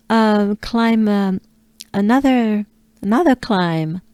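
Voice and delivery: ordinary delivery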